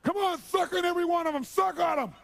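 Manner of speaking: high, loud screamed taunts; unhinged bullying yell; ten times crazy. Retold or imitated. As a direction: scream at them like a maniac. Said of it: "I go ten times crazy".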